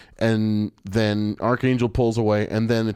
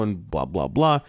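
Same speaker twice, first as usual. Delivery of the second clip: ordinary talk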